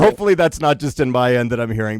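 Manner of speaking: normal talking voice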